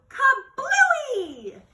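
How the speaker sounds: high, loud kablooey burst; explosion sound. A narrator's reading. The water balloon bursts over the stage, a loud high kablooey. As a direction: shout the pop of the balloon.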